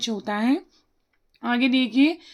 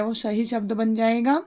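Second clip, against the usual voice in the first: normal talking voice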